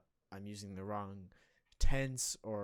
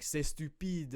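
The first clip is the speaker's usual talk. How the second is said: higher-pitched, hammed-up French accent; over-the-top French accent; 6 semitones higher, 4 dB louder, slower than usual. Student messing about at the end of French class, hammed-up French accent. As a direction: put on a playful, exaggerated French accent. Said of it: "I was messing and I was like".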